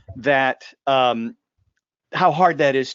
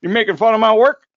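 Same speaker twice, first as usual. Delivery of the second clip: higher, louder mock indignation; offended installer; 7 semitones higher, 7 dB louder than usual. Installer in class spots his own job, mock offended. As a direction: act affronted, pitching the question up loudly. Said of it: "some guy looks at it and goes".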